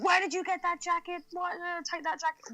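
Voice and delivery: retold screaming. Retelling a man screaming about a jacket, mock screaming. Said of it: "starts screaming at him, going".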